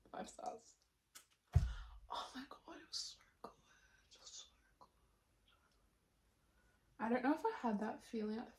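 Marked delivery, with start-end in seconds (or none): 0.1-4.9 breathless hushed whisper. Real, not acted. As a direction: whisper it, overwhelmed with love. Gushing over a finished book.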